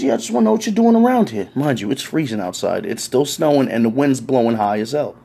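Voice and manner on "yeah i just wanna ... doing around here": lightly voiced suspicious cop, quick; a hint of a pushy cop, fast; mock cop voice. Cop questioning a man in the street, quick and pushy.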